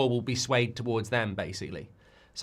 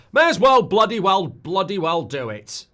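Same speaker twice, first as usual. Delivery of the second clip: belted out, higher and louder; loud catchphrase; 8 semitones higher, 9 dB louder than usual. Pretend. Streamer bellows his own catchphrase about putting Barcelona on, loud. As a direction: shout the catchphrase like a rallying cry. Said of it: "That's what I say".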